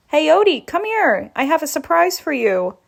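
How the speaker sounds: loud, high call to a dog. Acted. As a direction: call him over, sweetly luring. Calling the dog.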